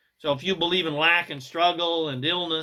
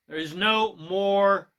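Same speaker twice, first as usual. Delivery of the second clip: normal talking voice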